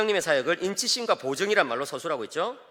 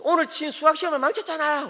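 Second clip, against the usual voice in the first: high, louder voice of a sulking son; whiny teen son; 12 semitones higher, 6 dB louder than usual. Retold or imitated. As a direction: play the upset teenage boy. Son's complaint voiced by dad. (투정 부리는 아들 목소리)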